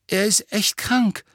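put-on high, anxious boy's voice; frightened. A narrator's reading. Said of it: "sagte eine ängstliche Stimme". (ängstlich, mit hoher Stimme)

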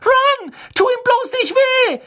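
very high, loud panicked screech; shrieking. A narrator's reading. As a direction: shriek it as a desperate plea. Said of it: "kreischte Hermine". (schrill kreischend)

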